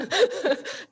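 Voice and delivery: loud high burst of laughter; helpless laughter. Real, not acted. Still laughing.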